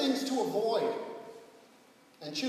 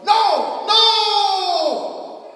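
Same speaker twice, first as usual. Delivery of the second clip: frantic cries of no, much higher and louder; desperate shout; 13 semitones higher, 14 dB louder than usual. Retold or imitated. Caught man yelling no as police find drugs, desperate shout. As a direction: yell it like the game is up.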